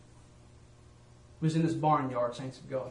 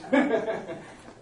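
short high laugh; real amused chuckle; 3 semitones higher than usual. Real, not acted. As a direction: laugh at yourself. Laughing about his singing.

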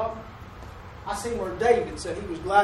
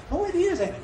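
cheery agreement; 10 semitones higher than usual. Retold or imitated. Retold cheerful chat with customer.

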